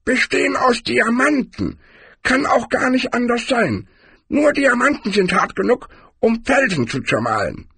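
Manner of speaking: very high-pitched barbarian character voice; high character voice, far from the narrator's; Cohen. A narrator's reading. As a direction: squeak it out high, explaining troll habits. Cohen explains troll teeth.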